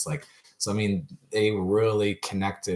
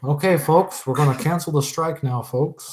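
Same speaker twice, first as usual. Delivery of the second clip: loud, higher politician's address; Doug Ford impression; 7 semitones higher, 6 dB louder than usual. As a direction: announce it loudly to the voters, folksy. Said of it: "your like, impersonations".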